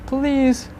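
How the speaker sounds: ordinary talk, nothing marked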